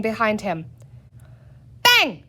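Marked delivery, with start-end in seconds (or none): 1.8-2.2 high, loud pretend gunshot; bang cried. A narrator's reading. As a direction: shout bang like a cap gun. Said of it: "cried Peter".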